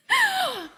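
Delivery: high, loud frog screech; frog impression. Shown as a demonstration. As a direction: shriek like a calling frog. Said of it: "Can you sound like this frog?".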